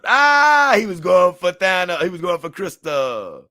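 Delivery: high, loud burst of realization; loud, high-pitched aha moment; excited realization. Real, not acted. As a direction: it suddenly clicks, shout it out. Reading chat, sudden realization.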